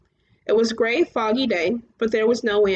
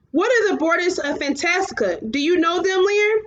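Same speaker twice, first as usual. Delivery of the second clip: raised, high questioning call; calling up to the giants; 6 semitones higher than usual. A narrator's reading. Atreyu calls up to the cloud giants, raised voice. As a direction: demand an answer from the sky.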